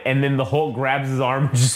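usual speaking voice

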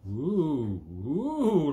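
loud high hooting ooh; funny noises. Retold or imitated. Masked man hooting at his passing friend, loud silly oohs. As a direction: hoot at him like a nutter. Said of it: "like this".

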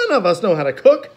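a hint of worried Klaus; lightly voiced Klaus. A narrator's reading. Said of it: "orphans in dismay".

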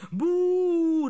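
loud high boo; booing chorus. A narrator's reading. Gnomes boo together at the accusation, loud boo. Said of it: "riepen alle kaboutertjes weer in koor".

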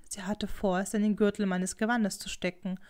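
plain reading voice

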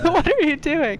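high-pitched pretend exasperation; hammed-up high cry of disbelief; mock shout. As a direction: scold in playful mock outrage. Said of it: "you may have to shout at me".

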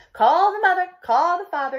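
high, loud shout of orders; principal yelling. Acted. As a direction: shout the order across the school. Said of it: "yelled the principal".

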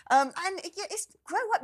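high exasperated grow up. Real, not acted. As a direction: blurt it out, fed up. Exasperated outburst.

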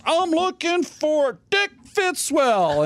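mock calling out. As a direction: call the name out slow and high. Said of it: "all you hear is".

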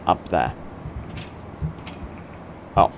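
normal talking voice

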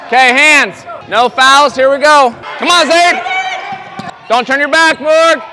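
loud, high coaching yells across the court; sideline shouting. Real, not acted. Coach yelling instructions to players on the court, loud. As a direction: call the plays out over the crowd.

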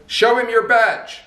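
high, slow call across the field; yelled back. Retold or imitated. Farmer yells back to the fleeing inspector, high and slow. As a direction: call out slowly with a dry jab. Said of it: "the farmer yells back and says".

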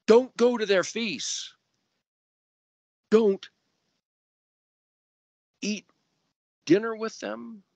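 high, loud preaching command; forceful commands. Acted. Preacher voicing the commandment, high and forceful. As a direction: proclaim each don't, high and loud.

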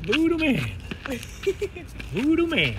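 high, loud gleeful squeal; excited squeal. Real, not acted. Gleefully squealing the dog's nickname.

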